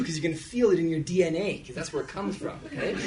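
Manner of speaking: usual speaking voice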